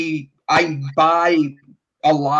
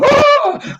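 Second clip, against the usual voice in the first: a sudden high yelp, much louder; mock stoned scream; 6 dB louder than usual. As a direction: squeal like someone far too high. Stoned person screaming, acted out.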